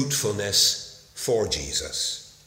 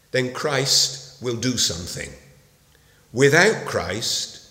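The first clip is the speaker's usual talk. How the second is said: normal talking voice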